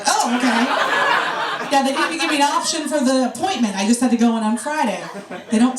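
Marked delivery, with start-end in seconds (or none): 0.1-1.7 stunned okay. Retold or imitated. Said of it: "I'm like".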